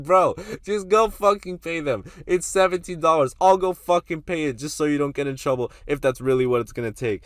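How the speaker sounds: loud, high, exasperated retelling; exasperated yell. Retold or imitated. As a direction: hammer it at him, fed up and loud. Urging panicked friend to pay.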